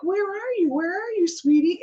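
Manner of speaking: put-on tender call to a child. Calling to the crying girl under the table, high and tender.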